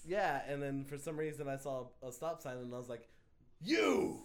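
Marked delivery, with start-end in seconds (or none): 3.6-4.2 high, breathy horror villain snarl; laughing mock slasher threat; Freddy Krueger impression. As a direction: menace the stop sign like Freddy Krueger. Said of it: "That was a really good Freddy Krueger impression".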